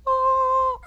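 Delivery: high, loud puppet "Oh"; puppet gasp. Acted. Puppet Mel surprised by the pastor. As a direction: squeal out like the puppet.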